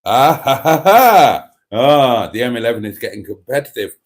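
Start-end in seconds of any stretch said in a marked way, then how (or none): 0.0-1.5 big open "ha-ha-ha" laugh, rising; loud laugh. Real, not acted. Host reacting to a cheeky review, loud laughing. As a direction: burst out laughing loudly.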